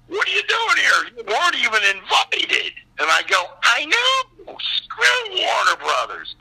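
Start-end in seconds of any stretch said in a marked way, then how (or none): 0.1-2.7 high-pitched disbelieving challenge; incredulous. Retold or imitated. Announcer spots an uninvited actor at the premiere, incredulous. 3.6-6.4 high-pitched defiant retort. Retold or imitated. Uninvited actor answers the announcer at the premiere, defiant.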